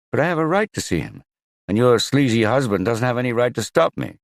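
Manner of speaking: high, loud and fast, furious; angry protest. Acted. Magnus shouting at Ingalif over seeing his son. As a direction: demand your right, spitting mad.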